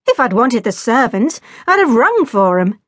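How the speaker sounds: exasperated, pointed retort. A narrator's reading. Jack scolds his cousin for not answering, exasperated. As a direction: put her in her place, mildly exasperated.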